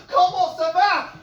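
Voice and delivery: high loud shouted Comment ça va; shouted call. Acted. Singer shouting the call for the audience to answer. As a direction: fling the call out to the crowd. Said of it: "I shout out".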